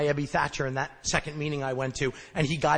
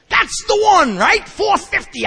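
much higher, loud mock triumph; sarcastic; 14 semitones higher, 9 dB louder, slower than usual. Mocking his tiny four-fifty amends, loud sarcastic triumph. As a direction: shout it like a grand prize. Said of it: "sarcastic as I do".

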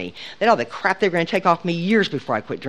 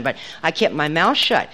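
ordinary delivery

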